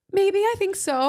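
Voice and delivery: high, slow, hesitant and sheepish; lightly voiced sheepish admission. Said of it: "I said".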